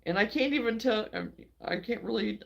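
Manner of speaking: ordinary talk, nothing marked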